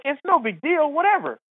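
high, singsong shrug; breezy dismissal. Retold or imitated. Comedian playing it cool beside starstruck buddy, breezily dismissive. Acts out his own nonchalant reaction.

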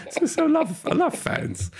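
giggling through the words; laughing high while talking. Real, not acted. Laughing while reading email.

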